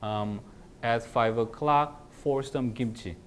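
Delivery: very slow, word by word English; teacher reading a model sentence slowly; slow demo sentence. Shown as a demonstration. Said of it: "이제 화려한 전치사 쓸게요".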